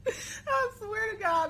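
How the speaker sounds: high, laughing and slowed. Real, not acted. Reader swears innocence while still laughing, laughing. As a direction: squeeze it out between laughs. Laughing fit at own story.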